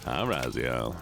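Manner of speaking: low Southern y'all; deep-pitched Southern call to the group; Southern accent. Pretend. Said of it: "I will do the Southern accent for a while".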